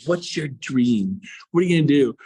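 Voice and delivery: ordinary delivery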